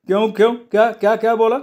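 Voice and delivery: high, agitated boss voice; indignant challenge. Acted. Irritated boss challenged over unpaid wages. (चिडून, चढ्या आवाजात)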